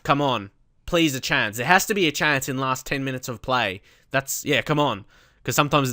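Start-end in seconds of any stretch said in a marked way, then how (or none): none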